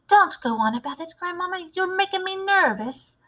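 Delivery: put-on little boy mouse voice.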